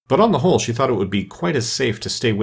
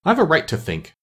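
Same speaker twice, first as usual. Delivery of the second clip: higher, mildly sharp retort; a touch of indignation, pitched up; 7 semitones higher than usual. A narrator's reading. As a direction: answer back sharply. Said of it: "said Alice sharply".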